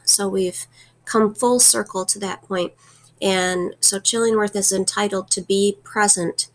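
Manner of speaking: ordinary talk, nothing marked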